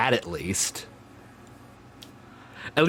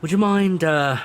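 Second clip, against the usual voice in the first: high, polite, hammed-up British police officer; bad British accent; 5 semitones higher, 3 dB louder than usual. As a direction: play a deliberately bad British traffic cop stopping a driver. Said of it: "bad British for the day".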